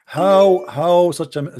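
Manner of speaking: ordinary delivery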